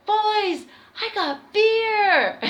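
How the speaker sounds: high, loud, slow victory call; triumphant announcement. Retold or imitated. Bursting onto the bus to tell the band, triumphant shout. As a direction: drag it out like a trophy win.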